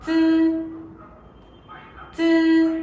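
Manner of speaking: "Chư" clear, unaspirated, with the tongue flat; model syllable. Shown as a demonstration. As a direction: say "Chư" twice for students. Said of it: "Các bạn cùng đọc lại theo cô nhé". (đọc mẫu "Chư" không bật hơi)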